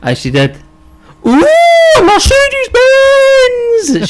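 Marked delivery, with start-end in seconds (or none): 1.2-4.1 very high, loud, drawn-out mock squeal; hammed-up ecstatic shout; enthusiastic impression. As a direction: imitate an over-excited shriek, stretching every word. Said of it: "Sadia was very enthusiastic".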